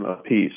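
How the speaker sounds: "Appice" with the stress on a final PEECE. Retold or imitated. Drummer recalls the singer's version, "[name]" said a-PEECE. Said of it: "[name] called him".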